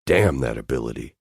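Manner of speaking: plain reading voice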